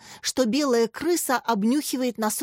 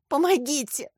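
higher-pitched plea for help; tearful; 5 semitones higher than usual. A narrator's reading. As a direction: cry for help, close to tears. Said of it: "Варя разрыдалась". (жалобно, почти плача)

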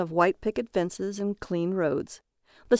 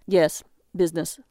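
icy; 2 semitones lower than usual. Acted. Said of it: "the iciness of Jaron's voice".

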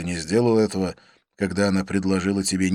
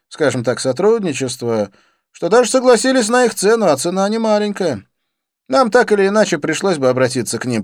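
higher, louder excited character voice; eager young friend; 10 semitones higher, 8 dB louder than usual. A narrator's reading. Friend justifying the deal with police, eagerly. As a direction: explain it all with keen, pressing energy. Friend explains the race arrangements.

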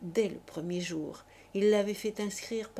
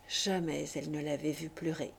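ordinary delivery, nothing marked

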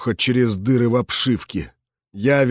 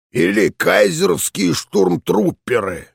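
put-on raspy old general shouting; 5 semitones higher than usual. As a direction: bark it out as a hoarse veteran. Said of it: "продолжал кричать мужчина".

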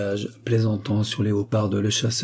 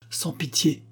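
raised pitch, cutting in without shouting; interrupting cry; 6 semitones higher, 3 dB quieter, slower than usual. A narrator's reading. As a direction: break in with a mild exclamation. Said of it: "s'écria la commandante en l'interrompant".

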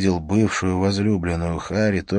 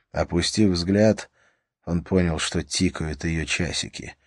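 ordinary delivery, nothing marked

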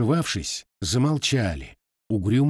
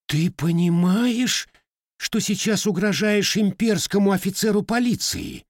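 bewildered; 11 semitones higher than usual. Acted. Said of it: "растерянным голосом поинтересовался ротмистр".

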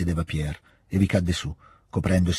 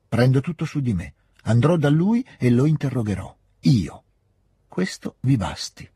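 high, forceful declaration; emphatic insistence; 6 semitones higher, 4 dB louder than usual. A narrator's reading. Prince taking it all upon himself, forcefully insistent. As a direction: take charge, driving up pitch and volume.

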